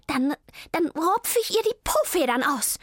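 high-pitched angry cat; hissing threat. A narrator's reading. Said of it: "fauchte Zelda". (wütend fauchende Katze)

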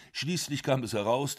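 usual speaking voice

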